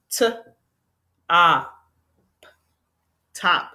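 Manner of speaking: "T", "ah", "p" as separate sounds, then blended "top"; sounding out. Shown as a demonstration. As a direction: phonics breakdown of "top" with pauses between sounds. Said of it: "and we have top".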